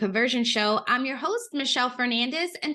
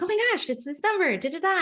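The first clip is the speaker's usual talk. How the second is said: high, quick, excited rush; excited retelling; 9 semitones higher, faster than usual. Retold or imitated. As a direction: re-enact your excited announcement, pitched up and fast. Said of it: "I got so excited with the number".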